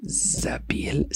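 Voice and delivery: slow whispered venue name. Shown as a demonstration. Host recommending the venue to listeners, slow whisper. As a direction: breathe the name Zabil Sarai slowly, like a secret.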